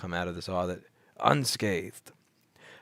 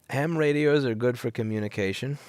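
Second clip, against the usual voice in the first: ordinary delivery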